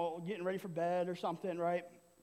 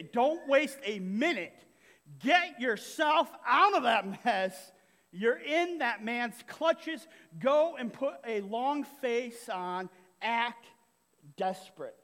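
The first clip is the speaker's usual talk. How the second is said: urgent reading; 6 dB louder, slower than usual. Acted. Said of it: "They say".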